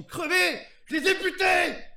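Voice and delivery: loud, high boast; defiant shout. Acted. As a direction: yell it out proudly.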